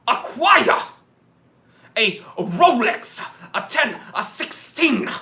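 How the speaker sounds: fired-up TV preacher begging for donations; hammed-up evangelist sermon; televangelist. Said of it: "uh, televangelists".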